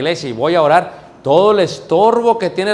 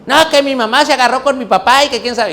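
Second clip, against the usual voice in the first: high-pitched imitation of a kid telling on parents; tattling child; 7 semitones higher, 3 dB louder than usual. Children telling the teacher about home, tattling child voice. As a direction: play a child blurting out family secrets. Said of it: "los niños te cuentan todo".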